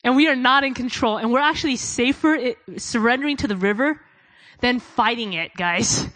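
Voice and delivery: full-force preaching. Acted. Preacher urging the congregation to surrender, loud and fervent. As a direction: preach it hard to the crowd.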